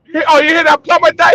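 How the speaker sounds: high, fast, alarmed Jamaican voice; Jamaican accent. Retold or imitated. The worker hearing the plumber died, alarmed Jamaican accent. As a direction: catch the news in a panic. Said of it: "He's like".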